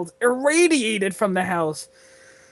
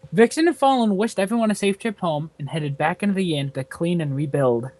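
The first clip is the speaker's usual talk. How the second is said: ordinary delivery, nothing marked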